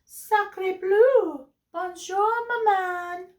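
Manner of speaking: high French-flavoured duckling; baby duck voice. Acted. Newly hatched duck greeting Jack, high and chirpy. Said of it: "looked up at Jack and said".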